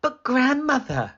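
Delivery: put-on mildly alarmed girl; alarmed little girl. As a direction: cry out in growing fear. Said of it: "she cried".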